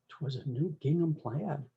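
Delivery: ordinary delivery, nothing marked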